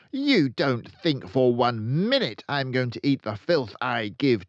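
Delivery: high, loud, ranting headmistress; furious Trunchbull. Acted. Headmistress accusing a boy of stealing cake, loud rant. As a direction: bellow the accusation like a tyrant.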